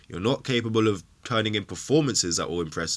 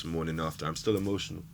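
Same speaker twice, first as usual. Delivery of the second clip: usual speaking voice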